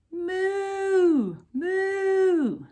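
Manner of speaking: high loud mooing cow. Pretend. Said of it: "He can go".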